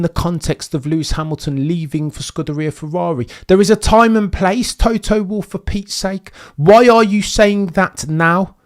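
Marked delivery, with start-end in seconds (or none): 3.5-8.6 animated rant. Real, not acted. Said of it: "I can't help but get animated".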